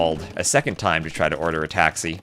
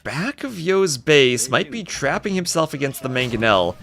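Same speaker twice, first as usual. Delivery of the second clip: excited play-by-play; 8 semitones higher than usual. Real, not acted. Caster calling Tato's retreat, excited and high. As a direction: ride the tension of the chase.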